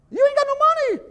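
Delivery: high, loud yell of disbelief; hammed-up dad squawking at ungrateful kids; mock indignation. Mock yelling at his kids.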